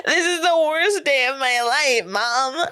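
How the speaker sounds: high-pitched mock wailing; imitating spoiled brat. Spoiled girl to mom at her party, high mock-crying whine. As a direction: cry it out like a pampered teen. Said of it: "they would be like".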